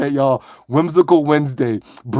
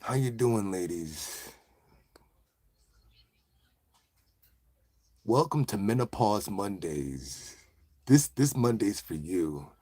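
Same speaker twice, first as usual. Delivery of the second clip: smooth ladies' man; 6 semitones lower, 9 dB quieter than usual. Pretend. Dude welcoming ladies to Menopause Mondays, smooth low voice. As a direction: play a suave late-night host, deep and unhurried. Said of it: "turned into one of those guys".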